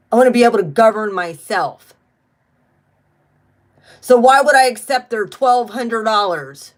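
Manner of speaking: real indignation, loud and emphatic; indignant rant. Real, not acted. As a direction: push each refusal out forcefully. Indignant rant against government stimulus money.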